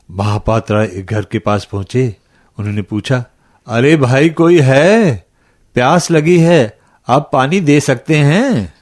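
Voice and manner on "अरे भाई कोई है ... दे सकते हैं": raised, higher-pitched call for help. A narrator's reading. Thirsty traveler at a stranger's house, calling out for water.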